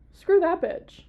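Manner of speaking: loud, scornful swipe; angry outburst. Real, not acted. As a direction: spit it out in disgust. Outburst at the murderer.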